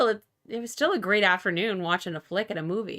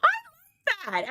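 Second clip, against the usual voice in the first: high drawn-out delight; much slower than usual. Real, not acted. Enthusing about the kids part.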